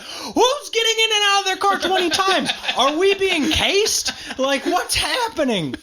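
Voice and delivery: high, alarmed, slowing on being cased; hammed-up nervous suspicion; imitating his own jumpy night-time worry; mock paranoia.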